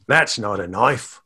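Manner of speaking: mock Australian with a rising end; Australian movie-quote impression; Australian accent. Shown as a demonstration. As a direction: put on a broad Australian accent. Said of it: "my Australian accent is".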